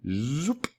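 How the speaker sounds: soft rising zoop sound effect; a light cartoon whoosh; playful zoop. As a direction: slide it upward like something stretching. Playful sound effect while pulling.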